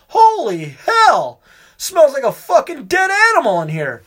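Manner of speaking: disgusted exclamation. Retold or imitated. Retelling the stinking bathroom.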